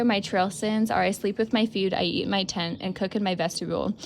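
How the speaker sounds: loud, animated read-aloud of a journal entry. Retold or imitated. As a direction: read the logbook entry out with gusto. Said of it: "reading the logbook".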